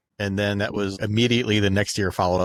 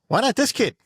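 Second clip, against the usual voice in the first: higher, eager pitch; lightly voiced agent; 7 semitones higher than usual. Agent pitching the kid, lightly voiced. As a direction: sell it like a hustling agent. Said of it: "His agent was just like".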